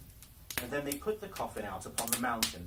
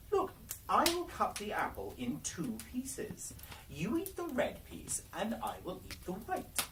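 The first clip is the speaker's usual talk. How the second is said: put-on higher, sly old woman's voice; old peasant woman; 10 semitones higher than usual. The disguised Queen at the dwarves' door, sly old woman. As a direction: sound harmless and offhand.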